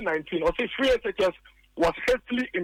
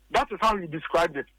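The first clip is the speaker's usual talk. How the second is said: ordinary talk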